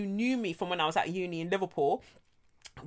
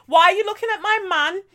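high, louder bad Liverpudlian accent; 12 semitones higher, 6 dB louder, faster than usual. Quoting the drunk girl in the club, bad Liverpudlian accent. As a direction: do a deliberately rubbish Liverpudlian girl. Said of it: "This is a very shit Liverpudlian accent".

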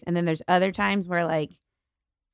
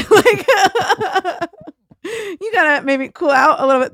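talking through real laughter, higher and louder; laughing; 9 semitones higher, 5 dB louder than usual. Real, not acted. Runner laughs at her own trail rage, laughing. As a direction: laugh it out while you speak. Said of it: "laughing while talking".

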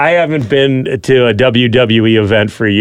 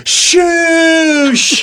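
high, shouted shoosh. Retold or imitated. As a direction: hush the crowd like a heel. Imitating a wrestler shooshing the crowd.

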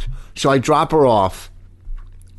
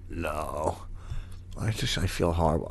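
sick and miserable; 8 semitones lower, 13 dB quieter than usual. Retold or imitated. Imitating sick friend.

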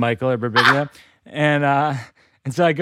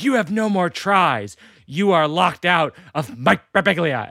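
computer verdict; 4 semitones higher than usual. Acted. Computer locking out its owner, stern and measured. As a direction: pronounce the lockout deliberately, word by word. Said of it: "the computer says".

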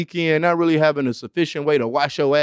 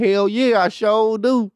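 bragging reply; 6 semitones higher, slower than usual. Retold or imitated.

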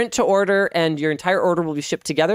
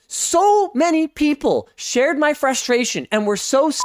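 angry rant; 7 semitones higher than usual. Real, not acted. Ranting at repeat sales calls.

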